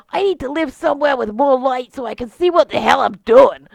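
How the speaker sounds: put-on loud, rambling Boston accent; old Boston guy dreaming of retiring to a farm; Bostonian accent. Retiring beholder tells the party his plans, loud Boston accent. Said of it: "the beholder with a Bostonian accent".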